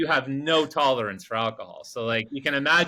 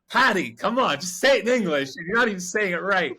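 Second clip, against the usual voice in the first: higher-pitched exasperated pleading; retold exasperation; 6 semitones higher, 4 dB louder than usual. Driver begging Patty to use English, mock exasperated. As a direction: plead with a hopeless helper, voice raised.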